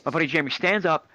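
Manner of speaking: usual speaking voice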